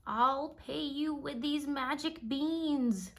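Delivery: a slow, gentle hint of an old stranger; lightly voiced old man bargaining with Jack; old man's voice. A narrator's reading. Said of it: "said the man".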